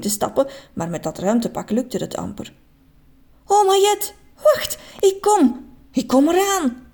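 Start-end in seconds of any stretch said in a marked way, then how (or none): 3.5-6.9 slow, high, stretched call; eager call. A narrator's reading. Man in heavy spacesuit calling to grandma, eager and high. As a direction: reach out to her. Mr Just hurries to her.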